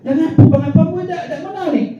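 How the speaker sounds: put-on high, teasing young wife voice; a new wife asking her husband if she isn't attractive, high and teasing; hurt wife. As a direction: pitch it up like a pouting bride. Wife asks if unattractive.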